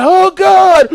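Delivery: high, loud wail; agonized mock cry. Patient reliving the twisting swab, agonized cry. Retold agony during nasal swab.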